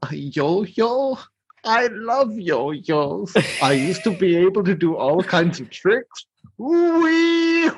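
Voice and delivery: high, hammed-up childlike delight; Burgermeister. Burgermeister given a yo-yo, high gleeful voice. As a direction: gush over a toy, stretching the words, ending in a high whoop. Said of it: "he gives him the yo-yo".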